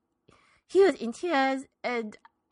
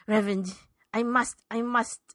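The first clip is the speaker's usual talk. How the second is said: ordinary talk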